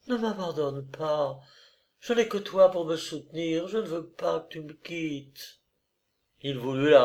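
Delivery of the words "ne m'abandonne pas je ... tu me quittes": higher, faint, tearful-sounding begging; desperate plea. A narrator's reading. Olga at her lover's knees, despairing. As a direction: beg weakly in a raised voice. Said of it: "se cramponna à lui avec désespoir".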